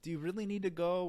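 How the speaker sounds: "Do you really need to go?" high and lifting; tentative questioning. Shown as a demonstration. Doctor timidly asking patient, high questioning inflection. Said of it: "It's not like a".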